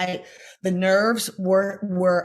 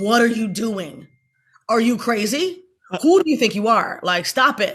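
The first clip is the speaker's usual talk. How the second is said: inner scolding voice; 3 semitones higher, 5 dB louder than usual. Retold or imitated. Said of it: "Tracy's in my brain going".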